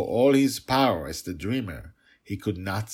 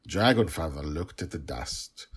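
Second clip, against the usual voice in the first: usual speaking voice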